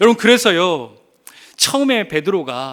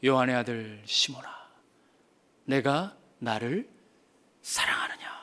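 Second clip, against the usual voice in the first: tender whisper; 8 semitones lower, 7 dB quieter than usual. Acted. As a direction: lean in and whisper the question. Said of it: "쓱 뒤돌아보시더니". (낮고 느리게, 속삭이며 묻듯)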